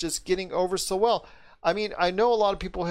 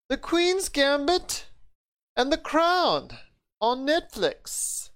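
hammed-up British accent, much higher and slow; horrible British accent; 10 semitones higher, much slower than usual. Host teasing the laughing guest, in a mock British accent. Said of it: "my horrible British accent".

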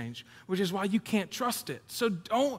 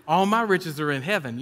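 laughing joke; 2 semitones lower than usual. Retold or imitated. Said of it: "And I was like".

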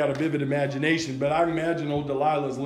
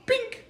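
high, sharp imitated snap; snap sound; 3 dB quieter than usual. Preacher mimics bowstrings snapping with a high pink. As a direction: make the sound of a string snapping.

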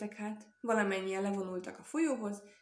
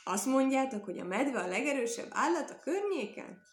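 louder, higher indignant call; shouting on; 5 semitones higher, 4 dB louder than usual. A narrator's reading. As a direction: keep the loud, raised challenge going. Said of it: "kiáltotta". (hangos, magasabb, kihívó kiáltás)

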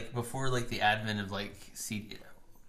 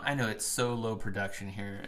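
normal talking voice

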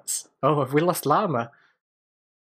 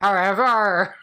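however, with a rolled Irish pirate r; Irish pirate accent; 6 semitones higher, 3 dB louder, slower than usual. Retold or imitated. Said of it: "I had my strongest Irish accent or a pirate".

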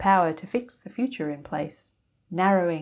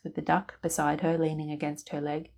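ordinary delivery, nothing marked

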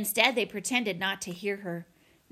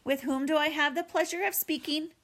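higher, courteous robot; pleasant, formal, pitched-up robot question; friendliest robot voice; 6 semitones higher than usual. A narrator's reading. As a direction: ask politely as a friendly robot. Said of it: "the robot's friendliest voice".

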